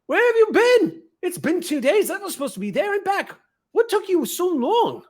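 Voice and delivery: high, loud, excitable gnome voice; high-pitched gnome, worked up and impatient. Acted. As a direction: pipe up high, pestering with questions. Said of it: "gnome ... is just scratching its fur and just going".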